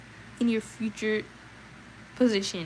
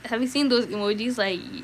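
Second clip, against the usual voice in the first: ordinary delivery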